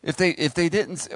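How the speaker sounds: usual speaking voice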